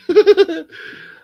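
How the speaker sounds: loud, high burst of laughter; real laugh breaking out at the joke. Real, not acted. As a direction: crack up loudly.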